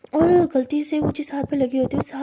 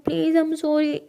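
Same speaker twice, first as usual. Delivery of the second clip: plain reading voice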